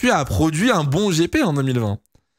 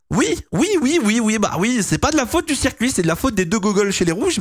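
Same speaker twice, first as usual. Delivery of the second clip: rapid high-pitched insistence; excited high protest; 8 semitones higher than usual. Real, not acted. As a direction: blurt it out, worked up. Defending Singapore, heated. (défense emportée, voix aiguë)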